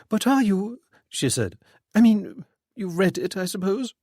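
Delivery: high, uneasy woman's voice; lightly voiced lady, anxious. A narrator's reading. Widow nervously asks Freddy, high uneasy voice.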